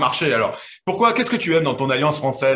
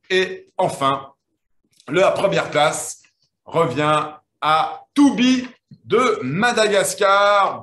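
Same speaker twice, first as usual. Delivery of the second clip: slow, drawn-out winner announcement; suspenseful reveal; 2 semitones higher, much slower than usual. Acted. Host building suspense for the first-place winner, slow and drawn out.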